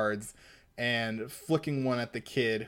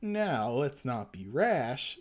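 slow calming voice; slower than usual. Acted. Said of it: "says the skinny, creepy-faced man".

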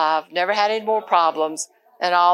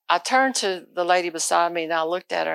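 ordinary delivery

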